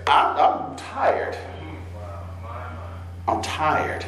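soft, slow, half-whispered weariness; mild weary God. Acted. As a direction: sigh it out, worn down. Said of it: "the Lord God ... saying".

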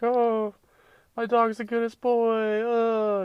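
very high, cooing mock girl voice; girly dog owner. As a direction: coo it like a doting dog mom. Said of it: "so many girls be like".

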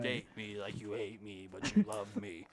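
low, unhurried corpse voice; talking corpse. Acted. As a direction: sink the voice low and sinister. Joins the corpse voice bit.

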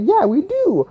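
high-pitched put-on server voice; imitated server. McDonald's lady admitting they have hot coffee, imitated.

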